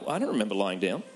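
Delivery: groggy just-woken voice. Retold or imitated. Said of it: "I wake up and I'm like".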